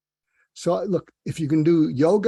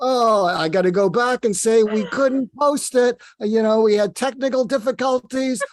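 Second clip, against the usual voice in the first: a higher, louder, hammed-up flustered host; mock excuse; 7 semitones higher, 5 dB louder than usual. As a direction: fake a sheepish excuse to the audience. Host acting out a made-up excuse.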